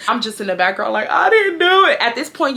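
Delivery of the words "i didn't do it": high-pitched whined denial; crying protest. Retold or imitated. Teen being dragged downstairs by mom, whining denial. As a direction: re-enact a whiny plea from the background. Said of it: "y'all, I'm crying".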